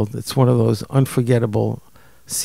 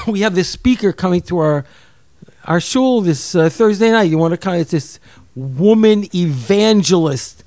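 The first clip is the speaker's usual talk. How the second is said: higher, louder friendly invitation; eager friend; 5 semitones higher, 5 dB louder than usual. Retold or imitated. Friend Bob calls to invite him to the shul. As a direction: invite him over the phone with enthusiasm. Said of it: "he called me up that night".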